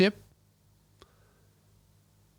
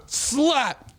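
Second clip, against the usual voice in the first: slap noise; 8 semitones higher than usual. Retold or imitated. Imitating the slap sound.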